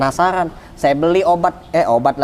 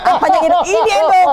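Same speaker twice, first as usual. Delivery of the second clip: high, loud excited back-and-forth; re-enacted yelling, breaking into laughter; retold shouted exchange; 10 semitones higher, 6 dB louder, faster than usual. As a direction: act out a startled question and jubilant answer. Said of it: "Saya teriak".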